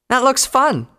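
very high, loud shout from a crowd; excited yell. A narrator's reading. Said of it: "some pony yelled from the crowd".

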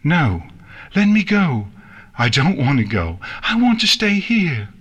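put-on high-pitched child's panicked cries; panicked screaming. Nix struggles against the princess's magic, screaming in panic. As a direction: cry out in panic, pitch leaping. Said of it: "she panicked and screamed".

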